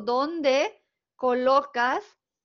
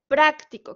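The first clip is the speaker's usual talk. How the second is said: "práctico" with PRÁC said louder; stress on first syllable; 3 semitones higher, 6 dB louder than usual. Shown as a demonstration. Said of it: "con una intensidad diferente".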